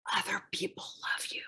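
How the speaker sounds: fast breathless whisper. A narrator's reading. Tiny frog whispering comfort into child's ear, fast whisper. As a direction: hush it into the child's ear, quick. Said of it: "and the frog whispers".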